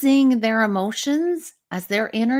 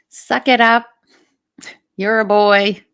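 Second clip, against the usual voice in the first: loud, punchy retold scolding; raised-voice mock reprimand; mock stern parent; 8 dB louder than usual. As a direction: imitate a parent ordering a child to toughen up. Voices parents scolding a crying child.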